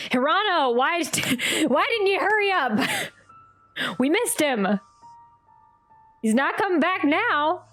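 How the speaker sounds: ordinary talk, nothing marked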